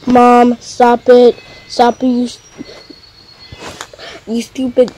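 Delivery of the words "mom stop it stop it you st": ordinary delivery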